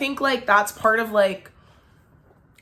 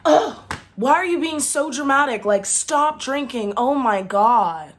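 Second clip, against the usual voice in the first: mock-exasperated boyfriend imitation; flipping out; 5 semitones higher than usual. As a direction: mimic his scoffing, put-upon outburst, drawn out slowly. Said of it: "he literally flips out".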